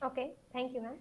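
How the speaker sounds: faint, low-volume voice; almost too quiet to hear; barely audible. Real, not acted. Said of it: "Madam, your voice is very low".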